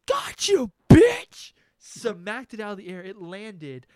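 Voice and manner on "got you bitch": high, rising to a loud shout; victorious yell. Retold or imitated. Swatting the hornet, a high triumphant shout. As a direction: scream it as you land the hit. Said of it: "And I was like".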